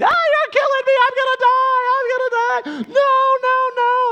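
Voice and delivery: loud, very high hammed-up child's shriek; screaming five-year-old. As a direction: wail as if the water is killing you. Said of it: "And he is screaming".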